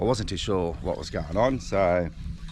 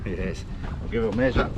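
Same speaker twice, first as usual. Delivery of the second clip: usual speaking voice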